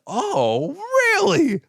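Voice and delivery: intrigued "oh, really", swooping up high; mock surprise. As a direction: play up sudden delight at juicy gossip. Retold eager reaction to gossip.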